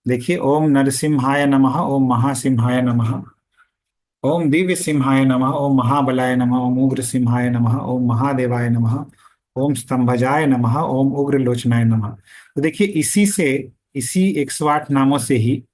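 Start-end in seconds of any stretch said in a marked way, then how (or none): 0.4-12.2 rapid spoken-style recital, little drawing out; quick mantra recitation. Shown as a demonstration. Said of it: "तो इस तरह से".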